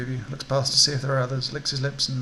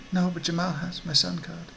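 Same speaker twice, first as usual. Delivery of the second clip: higher woman's voice; concerned bereaved woman; 4 semitones higher than usual. Acted. Grieving woman answering a stranger about a pass card, higher voice. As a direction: answer as the grieving mother, pitched up.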